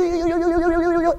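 put-on high, soft cooing at a little kid; baby cooing. Shown as a demonstration. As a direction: coo to make a toddler giggle. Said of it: "look at a little kid and go".

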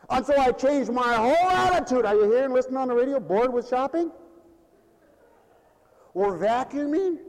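loud, high address to listeners; fired-up pulpit call; impassioned preaching. Real, not acted. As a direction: project it out to everyone listening. Preacher addresses audience and radio.

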